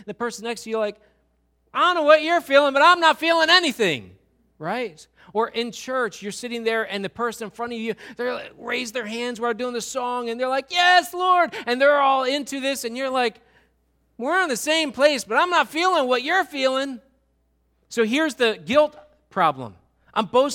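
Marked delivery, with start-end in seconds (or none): 1.7-4.2 loud, high, rapid-fire complaint; hammed-up outburst; mock protest. Acted annoyed person next to worshipper. 10.7-11.5 high, loud worship cry; hammed-up praise shout; mock fervor. Acted fervent worshipper in church. 14.2-17.0 high, loud complaint; hammed-up exasperated outcry; mock protest. Acted left-out churchgoer's protest.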